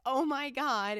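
high, loud, stunned Oh my God; shocked exclaim. Retold or imitated. Retelling her own shock at the gift, loud and high. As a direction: blurt it out in disbelief.

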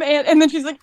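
ordinary talk

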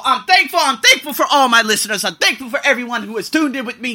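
thanks with a laugh in it; laughing, happy thanks. Real, not acted. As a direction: grin through the gratitude.

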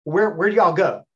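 imitating a puzzled Southern man; Southern accent. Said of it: "I do the Southern accent".

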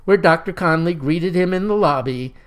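ordinary delivery, nothing marked

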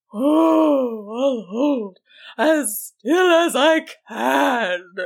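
high, slow, wailing troll voice; pained troll. A narrator's reading. Troll promises Susan to keep still, wailing reply. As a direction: moan it out long and pitifully. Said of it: "the troll responded".

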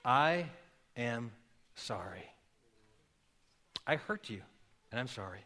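sincere apology. Shown as a demonstration. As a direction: apologize humbly, almost hushed. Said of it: "you need to say them very sincerely".

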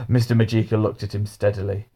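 ordinary delivery

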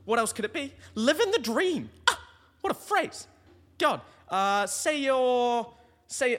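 very high and loud, hammed up; over-the-top excited. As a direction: bellow the catchphrase like a showman. Hammed-up catchphrase segment.

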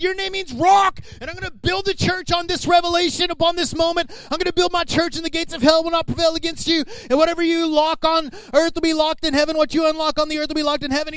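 kept high, lively blessing; excited Jesus. Retold or imitated. Jesus naming Peter the rock, high and eager. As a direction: proclaim it with rising joy.

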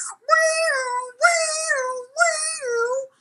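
rising and falling woo like an alarm; siren imitation. Crew member mimicking the ship's distress siren. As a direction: wail it out like a siren.

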